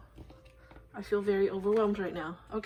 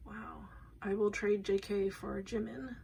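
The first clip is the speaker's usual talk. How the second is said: normal talking voice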